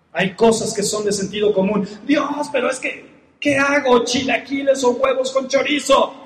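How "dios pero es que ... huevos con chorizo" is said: high, pleading mock believer; higher mock plea over breakfast; whiny mock prayer. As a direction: pester God like a fussy child over breakfast.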